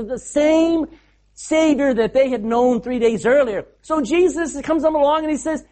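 normal talking voice

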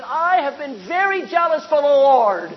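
loud complaint into the air. Acted. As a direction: protest to nobody in particular. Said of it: "begins to talk into the air".

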